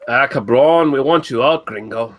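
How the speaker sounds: put-on Mexican accent, starting high and loud. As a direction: heckle in a mock Mexican accent. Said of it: "do it with a Mexican accent".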